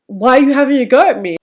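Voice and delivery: playing her touchy self, taking offence; hurt, defensive reply. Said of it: "I just went into sensitive mode".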